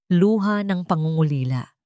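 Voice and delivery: plain reading voice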